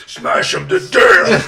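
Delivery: slow, low and very loud; joking roar. Pretend. Butting into a sparring partner's answer, joking roar. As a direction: bellow it as a mock battle cry. Mock-aggressive joke interrupting the answer.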